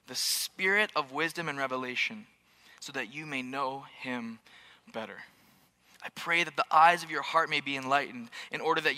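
hushed prayerful scripture; scripture reading, hushed. Acted. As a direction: read it as a prayer.

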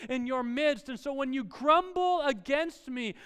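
loud, high, impassioned sermon; full-force preaching. Acted. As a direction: preach it out to the whole crowd.

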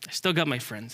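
low, soft self-reassurance; calmed down. Acted. Squirrel consoles himself after deep breath, calmed down. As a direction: settle down, speaking low and softly. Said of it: "took a deep breath and he realized".

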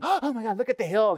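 high, thrilled gush of wonder; amazed tourist. Retold or imitated. Pastor imitates a visitor in awe, high and excited. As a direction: gush like a wide-eyed first-time visitor.

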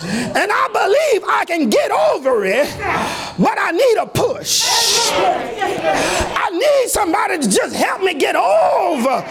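preacher pleading for a push over the hump; preaching at full force. Acted. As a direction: whoop it up with rising passion.

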